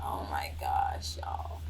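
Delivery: soft whisper, slow and breathless; whispered oh my gosh. Real, not acted. Whispering to viewers during the quiz, nervous. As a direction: hush it out as nerves hit. Nervous while guessing the singer.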